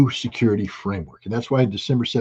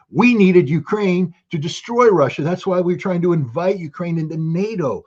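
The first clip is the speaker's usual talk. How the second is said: raised, higher, scornful voice of a Western leader; mocking Western leader; 9 semitones higher, 3 dB louder than usual. Speaker mimics Western leaders taunting the public, in a high scornful voice. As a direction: lampoon the leaders admitting they wanted war.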